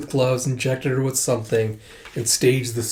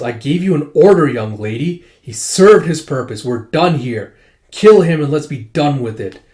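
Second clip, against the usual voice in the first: loud, stern orders from a father; angry father shouting at his daughter to kill; commanding father; 6 dB louder than usual. Retold or imitated. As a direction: bark the orders down at her like a furious parent.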